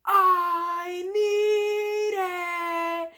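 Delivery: long, high, drawn-out wail; screaming. Retold or imitated. SpongeBob cracking and screaming for the water, long and loud. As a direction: stretch the scream out like a breaking dam. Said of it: "he's screaming".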